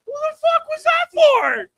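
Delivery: high, slow exaggerated disbelief; mock outrage. Comic asking the crowd about creepy doors, mock outraged. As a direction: squeal the question in fake shock.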